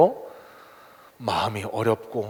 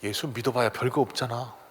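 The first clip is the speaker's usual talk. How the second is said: much softer, low sly taunt; Satan softly taunting a believer; Satan's whisper; 4 semitones lower, 8 dB quieter than usual. Retold or imitated. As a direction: murmur it like a tempter in your ear. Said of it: "이렇게 속삭일 수 있어요".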